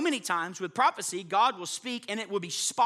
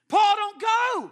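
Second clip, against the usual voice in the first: high, loud, slow plea; pleading cry; 13 semitones higher, 8 dB louder, slower than usual. Acted. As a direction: beg him not to go. Luke's friends pleading with Paul.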